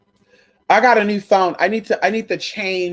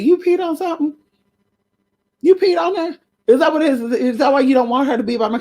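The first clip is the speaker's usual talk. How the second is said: scolding; 6 semitones higher, faster than usual. Real, not acted. Scolding pet for peeing.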